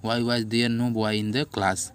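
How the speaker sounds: teacher dictating to the class, loud and slow; classroom dictation. Shown as a demonstration. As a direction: read each sentence out so the class can write it.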